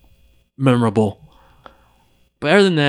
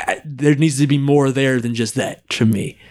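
ordinary delivery

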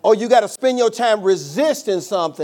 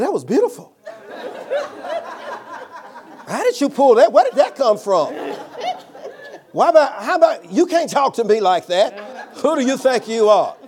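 louder, laughing through the praise; 8 semitones higher, 5 dB louder than usual. Retold or imitated. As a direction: crack up while marvelling at it.

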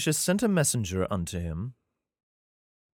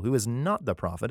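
ordinary talk, nothing marked

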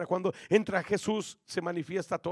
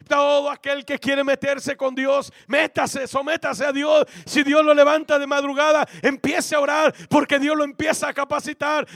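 louder, higher preacher's exhortation; rising preaching; 5 semitones higher, 10 dB louder than usual. Acted. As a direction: push the call to surrender harder. Prêche qui monte.

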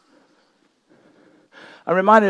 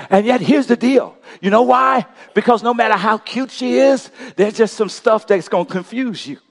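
raised, ringing preacher voice; preaching at full force; 9 semitones higher, 4 dB louder than usual. Real, not acted. Pastor preaching to the church, loud and ringing.

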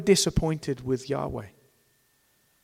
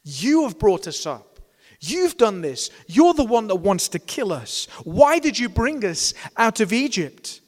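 higher, accusing whine thrown at Moses; complaining crowd; 6 semitones higher, 5 dB louder than usual. Retold or imitated. Preacher mimics Israelites blaming Moses, raised accusing whine. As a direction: blame him, voice climbing. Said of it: "they made their complaint".

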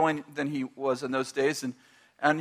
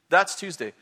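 ordinary talk, nothing marked

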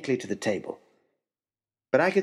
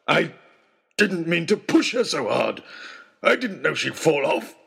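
defensive wild man; 4 semitones higher, 3 dB louder than usual. Acted. Trapper pleading guilt loudly.

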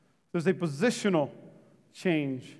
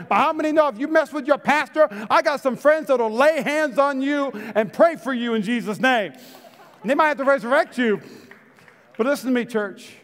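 preaching; 5 semitones higher than usual. Acted. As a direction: drive the joke home from the pulpit. Preaching punchline at full force.

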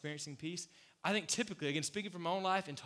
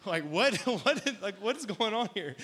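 mock shocked; 6 semitones higher, 9 dB louder than usual. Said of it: "And I was like".

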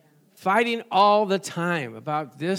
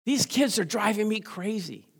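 exasperated parent; 3 semitones higher, 3 dB quieter than usual. Retold or imitated. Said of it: "things like".

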